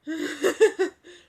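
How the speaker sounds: loud, high cackling laugh; burst of laughter. Real, not acted. Trying to settle down from hiccups, bursting into laughter.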